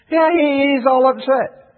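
ordinary talk, nothing marked

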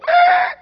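sudden very high loud yelp of alarm; startled shriek. Retold or imitated. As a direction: let out a shocked high cry. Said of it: "And we go".

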